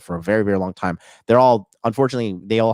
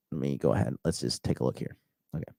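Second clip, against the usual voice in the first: under his breath; 8 semitones lower, 9 dB quieter, faster than usual. Real, not acted. Muttering to himself.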